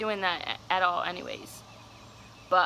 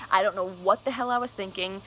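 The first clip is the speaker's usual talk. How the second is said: usual speaking voice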